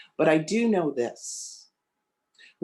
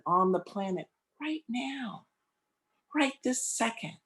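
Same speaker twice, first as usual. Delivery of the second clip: spoken prayer. Acted. Minister leading the congregation in prayer, reverent. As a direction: lead the room inward with calm conviction.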